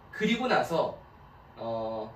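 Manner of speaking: ordinary talk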